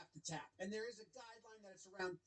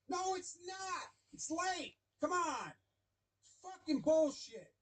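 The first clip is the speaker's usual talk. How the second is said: higher, louder, outraged protest; voiced furious rant; 7 semitones higher, 3 dB louder than usual. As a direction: rant like an ejected manager. Voicing manager's furious rant.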